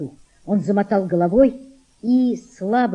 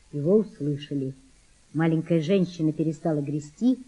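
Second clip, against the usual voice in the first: plain reading voice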